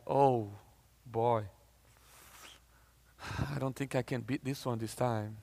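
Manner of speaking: low, hushed resignation; defeated. Acted. Preacher voicing someone facing bad news, quietly defeated. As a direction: sink into a soft, beaten murmur with long pauses.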